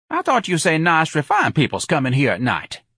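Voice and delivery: indignant janitor full of whoops; lively sardonic janitor. Acted. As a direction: wisecrack with big high exclamations and swooping pitch.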